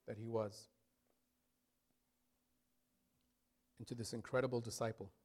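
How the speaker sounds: soft, low prayer voice with long pauses; leading prayer. Real, not acted. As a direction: guide the congregation gently into prayer. Leads prayer as worship begins.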